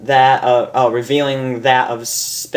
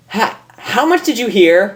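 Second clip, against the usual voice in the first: demanding; 8 semitones higher than usual. Acted. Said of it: "Rainbow demanded".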